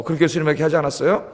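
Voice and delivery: ordinary talk, nothing marked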